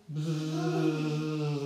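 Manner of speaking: long, steady buzz; imitated insect drone; buzzing flies. A narrator's reading. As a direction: hold a fly's buzz.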